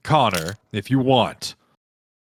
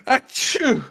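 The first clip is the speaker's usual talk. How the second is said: sneeze; 13 semitones higher than usual. Acted. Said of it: "one of the guards just go".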